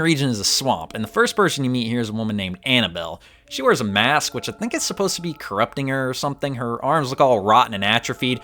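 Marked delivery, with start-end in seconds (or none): none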